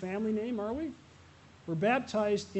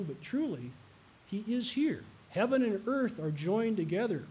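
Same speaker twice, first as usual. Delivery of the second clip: ordinary delivery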